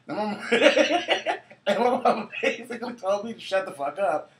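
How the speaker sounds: high, loud, real laughter through the words; laughing while talking. Real, not acted. Storyteller recalls mom shushing the crying child, real laughter. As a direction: crack up and push the sentence out. Cracks up after her crying imitation.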